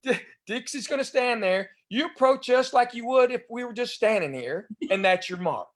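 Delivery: a lightly voiced Southern lady; Delta's voice. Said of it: "And Delta goes".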